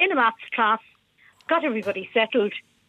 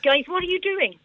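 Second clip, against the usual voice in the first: higher-pitched, puzzled teacher's question; 8 semitones higher than usual. Retold or imitated. Teacher asks pupils what they are doing, puzzled. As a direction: retell a teacher querying the class. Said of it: "And I said".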